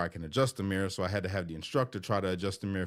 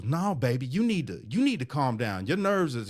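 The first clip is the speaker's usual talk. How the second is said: higher-pitched, motherly tough-love instructor, retold; scolding instructor; 6 semitones higher, 3 dB louder than usual. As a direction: lecture him like a fond but firm auntie. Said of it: "she was ripping into me".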